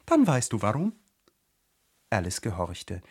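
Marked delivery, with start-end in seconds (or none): 0.1-0.9 higher, louder flower voice; imperious pitched-up flower; commanding. A narrator's reading. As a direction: finish the order emphatically. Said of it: "befahl die Tigerlilie".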